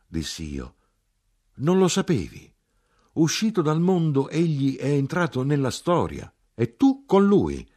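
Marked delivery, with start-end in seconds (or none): 1.6-7.7 high, loud, fervent declaiming; impassioned, raised-voice announcement; exalted insistence. A narrator's reading. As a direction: proclaim it excitedly, climbing to a shout. Acted exalted shouting match.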